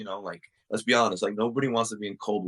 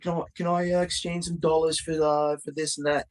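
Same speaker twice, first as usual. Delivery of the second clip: high-pitched put-on accent, accidentally Australian; attempted foreign accent; 9 semitones higher than usual. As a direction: imitate a clumsy fake Australian-sounding accent. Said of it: "Bro, you sound Australian".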